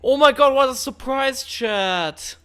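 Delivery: high, loud sarcastic gasp; overplayed mock shock; fake surprise. Sarcastic reaction to chat.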